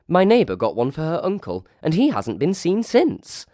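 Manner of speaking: high, quick gossipy voice; gossiping wench. Acted. Wench to the narrator, gossiping, high and quick. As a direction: rattle off the rumour like a giddy barmaid.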